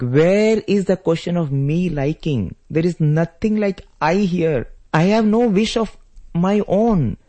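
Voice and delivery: high, drawn-out devoted protest; anguished plea. Acted. Lakshmana begging Rama not to offer choices, anguished plea. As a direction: plead intensely, stretching the key words.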